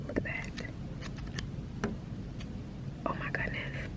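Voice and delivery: whispering at an animal outside; awed whisper. Real, not acted. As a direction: whisper so it won't run.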